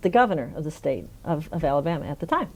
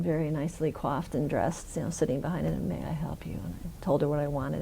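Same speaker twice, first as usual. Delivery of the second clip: soft whisper, like in a hushed office; 8 dB quieter than usual. Retold or imitated. Retelling the quiet Senate office receptionist, whispering. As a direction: keep it hushed as if not to disturb.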